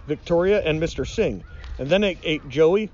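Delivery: usual speaking voice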